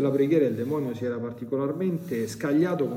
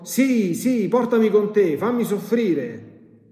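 high, louder mocking child voice; mimicking the child; 8 semitones higher, 5 dB louder, slower than usual. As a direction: demon parroting the little girl. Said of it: "ha detto subito". (il demonio imita la bambina)

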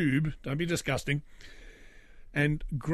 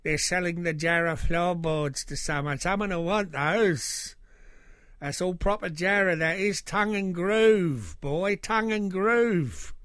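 hawker voice; 3 semitones higher, 3 dB louder than usual. Retold or imitated. Imitating a salvage seller.

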